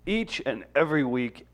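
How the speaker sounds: drawn-out show intro; announcer voice. Acted. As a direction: announce it like a host.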